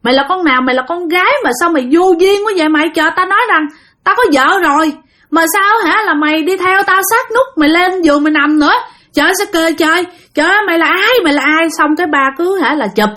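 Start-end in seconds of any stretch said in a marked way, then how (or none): 0.0-11.7 raised-pitch, quick indignant tirade; angry scolding. Retold or imitated. Father yelling at the ghost woman in his bed, indignant. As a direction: retell an angry yell without full volume. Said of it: "mà ba la lên nói".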